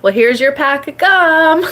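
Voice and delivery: high put-on friend voice; friend's voice. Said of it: "She's like".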